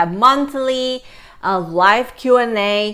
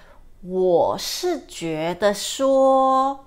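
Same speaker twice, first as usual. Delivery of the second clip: slow, distinct 我是觉得说; slow model phrase; much slower than usual. Shown as a demonstration. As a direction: teach 我是觉得说 slowly. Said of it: "you can say".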